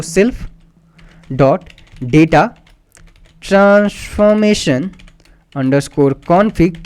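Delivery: very slow, stretched-out syllables; typing-along dictation. Real, not acted. Teacher typing the config variable for students, slowly dictating. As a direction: spell it out by sound as you type.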